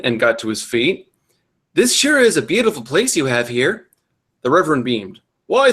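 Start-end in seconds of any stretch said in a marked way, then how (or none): 1.8-3.9 lifted, mildly eager compliment; enthusiastic. A narrator's reading. Boy admiring the reverend's place, mildly enthusiastic. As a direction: lightly play an eager young guest. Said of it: "Harry replied enthusiastically".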